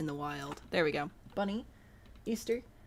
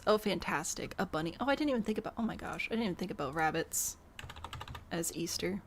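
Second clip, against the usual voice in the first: ordinary talk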